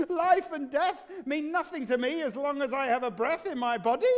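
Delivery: high voice, cackling through the words; manic laughing king. Acted. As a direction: laugh off the question like a madman. Mad king laughs off the murders.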